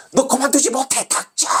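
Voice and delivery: high-pitched mock scolding; retelling the maestro's scolding in Korean, high and sharp; angry scolding. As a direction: snap it like an irritated superior. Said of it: "이런 뜻이에요".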